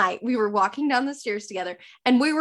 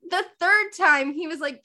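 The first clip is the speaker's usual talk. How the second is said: ordinary delivery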